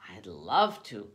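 loud, drawn-out exclamation; exclaiming. A narrator's reading. Spice seller accepting a pancake invitation, exclaiming. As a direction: exclaim it slowly with a strong punch. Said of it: "Kaya exclaimed".

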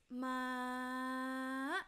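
"ma" straight, then tipping up at the end; high tone. Shown as a demonstration. Teacher showing fourth tone, "ma" straight then up. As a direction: hold "ma" level and flick it up late. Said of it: "It goes straight first".

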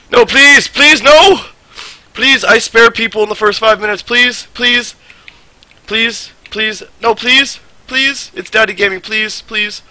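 high, loud desperate begging; anguished denial. Real, not acted. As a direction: plead for your life. Begging for his life in game.

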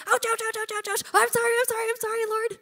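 high-pitched yelping ouches and sorries; mock pained whimper. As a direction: squeak out apologies like you've been stung. Retold wince at God's rebuke.

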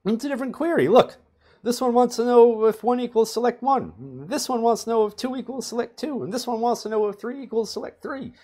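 high, excited voice for SQL Server; squeaky gleeful voice of a database; SQL Server talking. Pretend. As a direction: play the server as a giddy, high-pitched character. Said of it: "S Q L Server is like".

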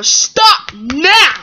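loud, high shouted order; angry yell. Acted. Brother orders Gordon to stop, shouting. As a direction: command it at full voice.

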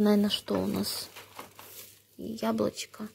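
ordinary talk, nothing marked

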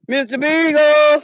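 slow, drawn-out loud call; calling for the dog. Real, not acted. Owner calling again for lost dog, long drawn call. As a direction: stretch the name long into the woods.

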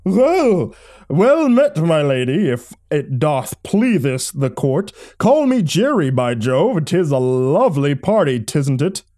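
high, loud, swooping mock old-timey accent; hammed-up courtly old English accent; strange old-timey accent. A narrator's reading. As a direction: greet a lady in a theatrical old-timey accent. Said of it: "greeting with a strange accent of his own".

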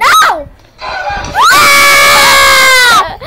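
loud high scream. Real, not acted. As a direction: scream in fright. Caught by Granny.

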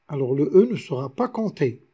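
ordinary talk

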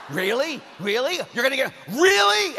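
high, loud incredulous outburst; re-enacted angry disbelief. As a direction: build to an outraged shout. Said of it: "I was so angry".

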